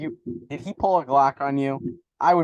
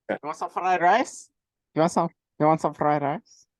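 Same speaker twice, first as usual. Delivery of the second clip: questioning Chinese-accent impression; mock Chinese accent; 3 semitones higher than usual. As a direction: imitate a Chinese cook offering food. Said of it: "Like that?".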